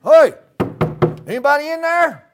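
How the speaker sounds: loud high call out; calling through the door. Retold or imitated. Stranger at the door calling in, loud. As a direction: call into a dark house. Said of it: "somebody they don't know like me shows up".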